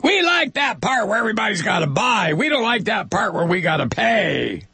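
high petulant witch voice. Acted. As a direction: whine it as the insurer witch. Insurers voiced.

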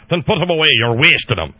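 put-on a stern old Scotsman scolding; Scottish accent. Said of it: "I got Scott's background".

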